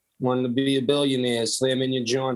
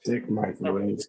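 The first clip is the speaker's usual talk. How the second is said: deep, soft announcer echo; low deadpan voice; 7 semitones lower, 3 dB quieter than usual. Acted.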